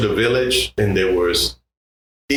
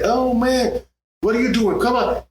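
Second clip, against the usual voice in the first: excited Sal; 12 semitones higher than usual. Retold or imitated. Sal voiced in story.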